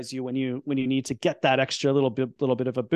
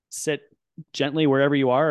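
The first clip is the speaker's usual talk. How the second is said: ordinary talk, nothing marked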